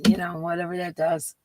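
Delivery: usual speaking voice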